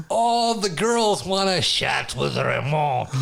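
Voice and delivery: slow, lightly voiced mock French accent; a hint of fake French accent. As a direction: savour it like a French seducer. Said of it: "speak with this French accent".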